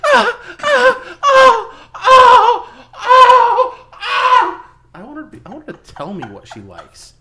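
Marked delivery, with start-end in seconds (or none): 0.0-4.7 high, loud fake moans; mock moaning. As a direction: ham up exaggerated sex moans. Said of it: "I don't wanna just hear".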